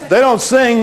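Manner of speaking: ordinary talk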